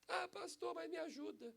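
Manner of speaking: high whiny plea, mother voice; begging mother. Retold or imitated. Mother begs the pastor for help, high whiny plea. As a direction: beg like a desperate mother.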